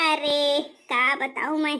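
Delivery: ordinary talk, nothing marked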